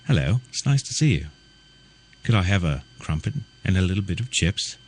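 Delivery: lightly put-on British accent, a hint of posh caricature; mock British accent. Shown as a demonstration. A mocking host to his American audience, lightly put-on British accent. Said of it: "they talk like this".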